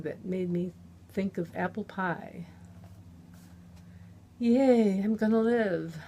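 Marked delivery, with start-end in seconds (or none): 4.4-6.0 loud, high sing-song cheer. Acted. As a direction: chant it like a happy child. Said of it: "My voice came out in a sing-song".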